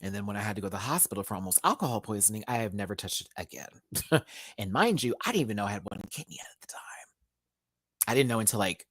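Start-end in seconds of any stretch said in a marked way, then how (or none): 5.2-6.8 hushed, rushed whisper; quick whispered aside; fast whisper. Real, not acted. Aside about having one kidney.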